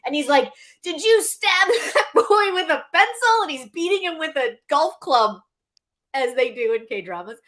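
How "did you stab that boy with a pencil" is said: raised, higher-pitched imitation of an accusation; retold scolding, louder and higher; mock angry grandfather. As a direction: confront the boy in a hammed-up outrage. Grandfather angrily confronting abused boy.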